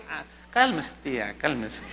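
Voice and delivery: low, soft hushing; acted calming. Nephew calms his wailing aunt at the burial, softly. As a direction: settle her down quietly and low.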